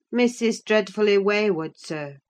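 calling out. A narrator's reading. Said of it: "I called out".

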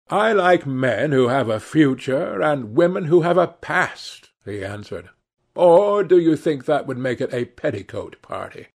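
lightly voiced Lord Henry. A narrator's reading. Lord Henry amusing his hostess, lightly voiced gentleman. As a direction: play the cynical dinner guest, amused and light.

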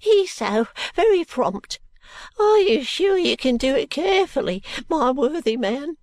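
put-on high, anxious old man's pleading; flustered, high-pitched fussing; fretful old man. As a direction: hurry out a nervous plea for care. Old man frets as he is carried.

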